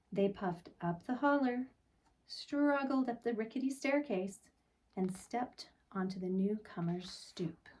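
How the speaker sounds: plain reading voice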